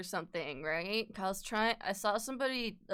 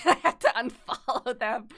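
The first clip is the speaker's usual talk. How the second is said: louder, laughing through the words; 3 semitones higher, 7 dB louder than usual. Real, not acted. Streamer admitting an awkward unfollow, laughing. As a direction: crack up as you say it.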